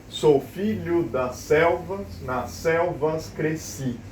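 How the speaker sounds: slow, evenly beaten verse; syllable-by-syllable scansion. Shown as a demonstration. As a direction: space out the syllables for counting. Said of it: "a mesma coisa aqui".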